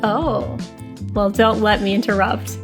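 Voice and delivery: ordinary delivery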